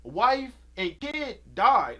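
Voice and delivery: loud, slowed outrage at the plot; indignant outburst. Real, not acted. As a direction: shout it out in disbelief. Ranting about the movie plot.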